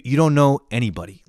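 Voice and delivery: ordinary talk, nothing marked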